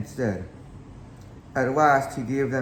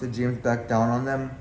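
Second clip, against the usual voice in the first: ordinary delivery, nothing marked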